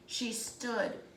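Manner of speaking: plain reading voice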